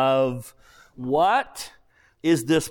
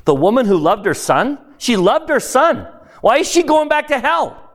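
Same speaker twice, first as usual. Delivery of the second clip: rising higher and louder in disbelief; building disbelief, higher and louder; incredulous objection; 8 semitones higher, 6 dB louder than usual. Pretend. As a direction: protest like a puzzled reader who can't accept it. Voicing a reader's indignant objection.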